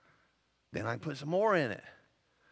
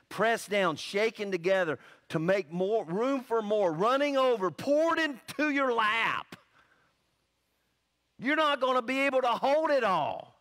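climbs higher and louder to a ringing peak, slowing at the top; building preaching climax; 7 semitones higher, 4 dB louder than usual. Acted. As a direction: preach it up to the crest.

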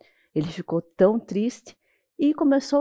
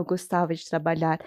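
softer, wry correction of gostava; ironic aside; 6 semitones lower, 5 dB quieter than usual. Shown as a demonstration. Narrator correcting herself about the hardworking brother, wry and soft. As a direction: take back gostava with a quieter ironic tone. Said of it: "não que ele gostava".

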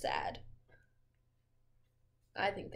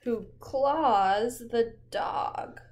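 slow, loud and dramatic; 10 dB louder, much slower than usual. Pretend. Reading the chaos to the child, slow and loud. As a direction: draw each word out with suspense.

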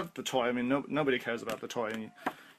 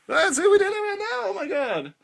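much higher and louder, bursting with glee; excited shriek; 7 dB louder than usual. Real, not acted. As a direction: shriek it out like you just pulled off the trick. Thrilled at joke working.

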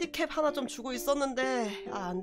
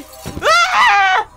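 long scream; 13 dB louder, much slower than usual. Real, not acted. Scared scream in game.

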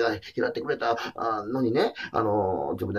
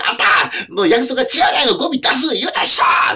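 higher, louder scolding shout at a neighbour; angry scolding; 12 semitones higher, 9 dB louder than usual. Retold or imitated. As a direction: bellow it like you've caught her breaking her promise. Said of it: "怒らはるシーン".

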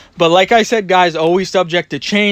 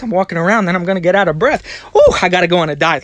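normal talking voice

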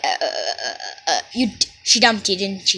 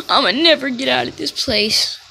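ordinary talk, nothing marked

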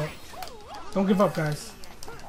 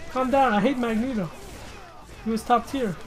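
normal talking voice

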